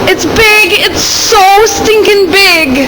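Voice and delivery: slow, high stretched-out alarm. Real, not acted. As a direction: drag out the shock of its size. Alarmed at the bird's size.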